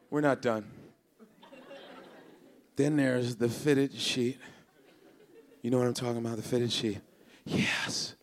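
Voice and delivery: normal talking voice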